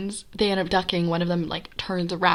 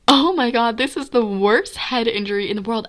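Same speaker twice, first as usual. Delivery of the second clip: retold panic; 4 semitones higher, 5 dB louder than usual. Thinking after head injury.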